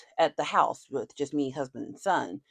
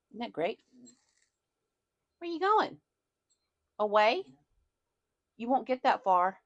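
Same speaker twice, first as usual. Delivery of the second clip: higher cooing pet voice; 5 semitones higher than usual. Real, not acted. Owner teasing her dog, cooing baby talk. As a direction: tease the dog sweetly.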